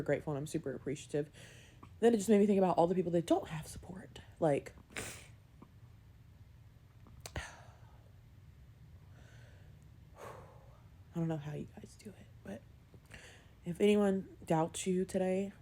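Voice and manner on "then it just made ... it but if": softer, whispered through tears; tearful whisper. Real, not acted. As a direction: whisper it, too moved to speak up. Overcome while talking about support.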